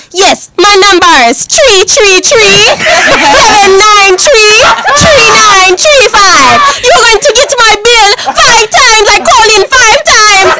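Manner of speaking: high-pitched, drawn-out Jamaican caller; Jamaican accent. Retold or imitated. Customer cussing out the Sprint phone worker, Jamaican accent. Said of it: "like, the Jamaican accent".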